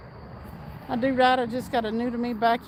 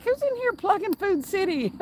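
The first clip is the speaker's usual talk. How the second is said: mock suspicious question; 8 semitones higher than usual. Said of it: "I was like".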